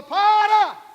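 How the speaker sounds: very loud high shout of stop; Jesus shouting stop; commanding shout. Acted. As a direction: cut the wailing with one cry. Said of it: "de repente ele falou".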